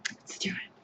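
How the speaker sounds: whispered psych-up; whispering. Real, not acted. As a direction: whisper it to yourself. Steeling herself in whisper.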